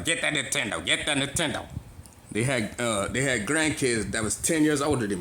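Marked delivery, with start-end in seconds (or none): 0.0-1.7 lightly voiced old person, a touch softer; a hint of an elderly parent nagging; imitating old foster parent. Foster parents who called everything Nintendo, lightly imitated. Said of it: "Used to call everything Nintendo".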